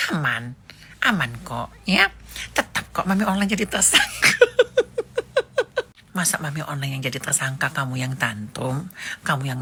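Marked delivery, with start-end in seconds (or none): 4.4-5.8 shrill mocking cackle; high laugh. Real, not acted. Taunting the reporter with a high laugh. Gloating over suspect status.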